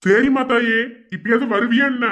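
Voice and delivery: exasperated sarcasm. Acted. Exasperated with wife.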